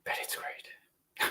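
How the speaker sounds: slow whispered confession; guilty whisper. Real, not acted. Reviewer admitting the overpriced scent is great, whisper. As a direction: whisper it like giving in.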